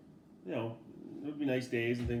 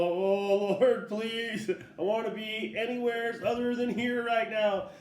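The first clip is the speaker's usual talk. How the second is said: higher, pleading prayer under the breath; mock frightened plea; 9 semitones higher, 4 dB louder, slower than usual. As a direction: beg the Lord to get you out. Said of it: "thinking to myself".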